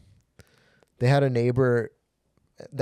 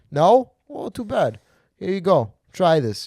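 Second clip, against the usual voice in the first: high, loud mock pushiness; hammed-up food bully; pushy insistence; 7 semitones higher, 7 dB louder, slower than usual. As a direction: force it on them in a raised voice. Acts out forcing food on people.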